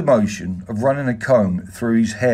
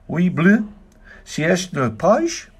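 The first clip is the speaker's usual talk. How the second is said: higher, drawn-out imitation of a French accent; Utz's weird French accent; 8 semitones higher than usual. A narrator's reading. Visitor imitating an absent friend at lunch, weird French accent. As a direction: put on an odd, high-pitched French accent. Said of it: "I tried to imitate Utz's weird French accent".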